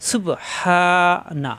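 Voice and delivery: সুবহানা very slow, the হা pressed; slow careful recitation. Shown as a demonstration. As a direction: draw out সুবহানা with one alif length. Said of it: "মাদের হরফ এক আলিফ টান". (ধীরে সুবহানা)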